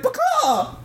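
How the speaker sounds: high chicken squawk with a falling, sad end. Retold or imitated. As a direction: squawk again, deflated because she doesn't get it. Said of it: "he's doing it really sad".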